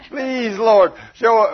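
frantic pastor praying on the drive down; desperate begging prayer. Acted. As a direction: beg God like your life depends on it. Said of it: "Oh, he's begging, pleading".

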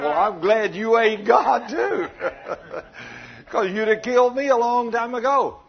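loud laughing retort; laughing loud comeback; laughing reply. Retold or imitated. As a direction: laugh through the punchline. Preacher laughs retelling his reply.

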